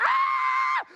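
high, loud sword-swing yell; preacher voicing Peter's battle cry; mock battle cry.

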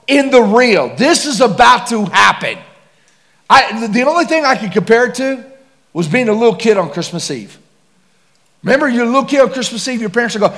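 loud, high, fast-building preacher climax; preaching at full force. Acted. Preacher telling the congregation his excitement before death, full-force preaching. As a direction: swell it up like a revival preacher.

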